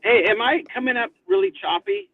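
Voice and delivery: usual speaking voice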